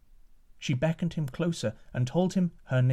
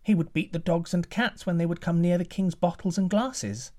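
put-on boy's voice; 7 semitones higher than usual. Butler's boy answers giant.

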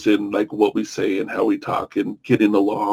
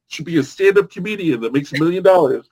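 excited cheer; 7 semitones higher, 3 dB louder than usual. Retold or imitated. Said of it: "people like me that aren't used to it are like".

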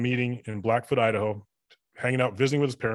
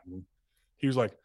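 normal talking voice

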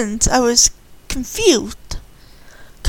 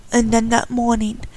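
ordinary delivery

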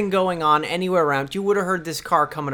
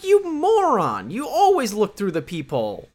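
mock exasperation; 8 semitones higher than usual. Scolding the character who opened the door, mock exasperation. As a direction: berate a fool in hammed-up disbelief.

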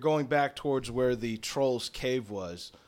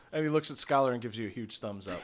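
ordinary talk, nothing marked

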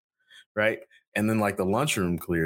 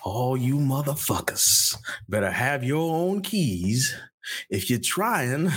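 slow, higher put-on Denzel voice; Denzel impression; 4 semitones higher, slower than usual. Redoing his old Denzel bit for the podcast, slow put-on voice. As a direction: drawl it out like Denzel. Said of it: "I did Denzel".

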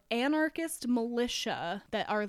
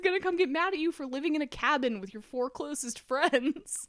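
incredulous mockery; 8 semitones higher, 6 dB louder than usual. She mocks the militia's paranoia.

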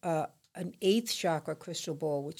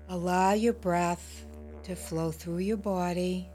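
soft spa whisper; 6 dB quieter than usual. Acted. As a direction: gently guide them into calm. Leads a guided meditation.

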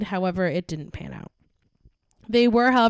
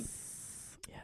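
unvoiced hushed yes; whisper; 25 dB quieter than usual. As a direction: breathe it, no voice. Joking whisper reply.

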